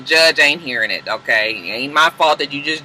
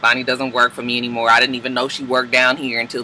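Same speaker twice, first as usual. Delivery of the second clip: lower, quieter acted denial; cool denial; 6 semitones lower, 4 dB quieter than usual. Annalise denying to Nate that Bonnie is her mole, cool and low. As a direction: deny it coolly, keeping it low. Annalise coolly denies Nate's accusation.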